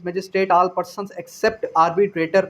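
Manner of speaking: normal talking voice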